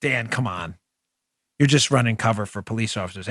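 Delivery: low, soft, dismissive heckler voice; mock critic.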